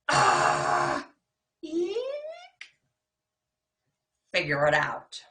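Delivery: loud startled yelps; startled cries. Acted. Kids yelping as their monsters leave. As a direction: yelp as they're left alone.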